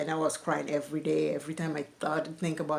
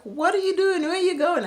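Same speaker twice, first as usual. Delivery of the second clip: alarmed calling out; 12 semitones higher, 5 dB louder than usual. Retold or imitated. Sister running after her, alarmed.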